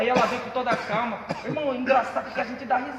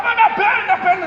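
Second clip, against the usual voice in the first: retold pain cry; 9 semitones higher, 10 dB louder than usual. Retold pain while dragged.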